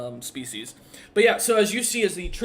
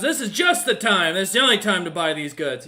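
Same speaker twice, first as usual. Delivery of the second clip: loud salesman's pitch, higher on the first line; pushy merchant; 4 semitones higher, 7 dB louder than usual. Acted. Merchant pitching his wares to the party, loud and eager. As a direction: sell hard, it's now or never. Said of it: "he turns and goes".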